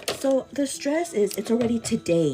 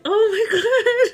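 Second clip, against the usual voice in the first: high, loud, slow laughing panic; freaking out; 12 semitones higher, 8 dB louder, slower than usual. Retold or imitated. To her viewer, retelling her panic with a laugh. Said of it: "I'm, like, freaking out".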